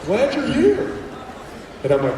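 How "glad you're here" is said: high, sing-song reply; awkward upbeat adult. Retold or imitated. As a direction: chirp it awkwardly. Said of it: "they're like".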